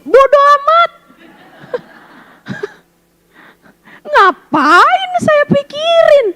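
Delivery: high, slow, laughing don't-care voice; high mock carefree sigh; carefree shrug. Acting carefree inner self, laughing.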